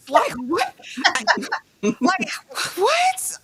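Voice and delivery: high-pitched, halting, laughing bewilderment; baffled disbelief. Real, not acted. Co-host thrown off by the exchange, baffled disbelief. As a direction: sputter it out, too amused to finish.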